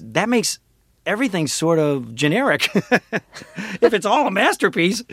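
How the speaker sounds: ordinary talk, nothing marked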